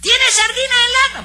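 hammed-up falsetto of a fussy customer; mocking Nananina's voice. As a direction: mimic the customer in a shrill falsetto. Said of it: "yo hablo así con esa voz".

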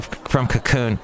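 usual speaking voice

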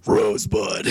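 low, loud growled whisper of a movie line; gravelly movie line. Retold or imitated. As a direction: growl it like a tough action hero. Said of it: "it would be him going".

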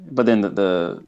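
usual speaking voice

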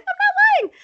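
tiny, very high squeal; squeaky high indignant denial; mock child's protest. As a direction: squeal it after someone walking away. Child calls after teacher.

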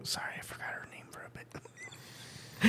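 soft, voiceless apology; whispered aside. Real, not acted. Husband excuses forgetting a name, whispered aside. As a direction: whisper it as a sheepish side note.

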